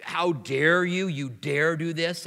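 slow, stern rebuke; strong rebuke. Retold or imitated. Paul rebuking the church, slow and stern. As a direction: scold the church for their failure. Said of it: "Paul's still using really strong language".

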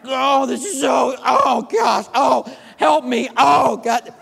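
loud, drawn-out groans of agony; loud moaning cries for help; mock groaning in pain. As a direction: ham up the pain, crying out. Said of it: "I'm like".

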